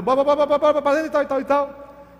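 high, loud, urgent robber's commands; armed robber. Retold or imitated. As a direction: bark it like a gunman rushing. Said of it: "Os caras armados entram, vai". (assaltante apressado, alto e agudo)

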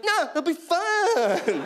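high, slow, singsong; hammed-up cheerful friend; mock-chirpy coaxing. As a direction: sell camping like it's a party. Friends coaxing him to camp.

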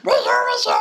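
high squeaky duck voice; Donald Duck voice. Acted. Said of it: "c'est pas ça la voix de Donald".

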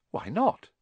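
put-on high, puzzled boy's question; Harry. A narrator's reading. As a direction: press innocently for the reason.

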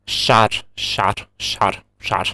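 «шар» slushy, air out the side; tongue pulled sideways on «шар»; lateral lisp. Shown as a demonstration. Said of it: "появляется что-то вроде вот хлюпанья".

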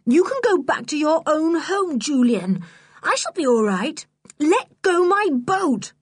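high, cross refusal, slowing on the last words; cross girl. Acted. George refusing Julian's help, crossly insisting. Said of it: "getting cross".

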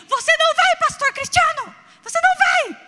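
loud high yell, begging him to stay; desperate shout. Retold or imitated. Daughter yelling at pastor Cristiano to stay, loud high shout. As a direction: cry it out high and loud, holding on.

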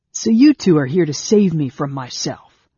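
loud sarcastic jab; bitter sarcasm. A narrator's reading. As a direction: throw it at them with bitter scorn. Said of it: "saying with bitter sarcasm".

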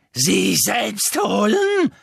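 high, loud, very slow shriek; Bellatrix shriek. Acted. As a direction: shriek it like a mad witch. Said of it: "kreischte Bellatrix".

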